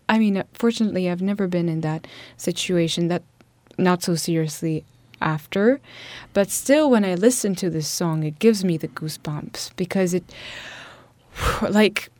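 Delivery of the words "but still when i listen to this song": normal talking voice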